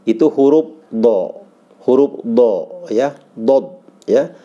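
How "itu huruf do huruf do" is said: slow thick do; teacher showing dhad; heavy do. Shown as a demonstration. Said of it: "setengah lidah".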